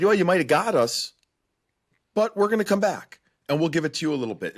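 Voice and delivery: high-pitched imitated team comeback; acted-out fighting reply; defiant retort. Voices the Bruins' defiant reply.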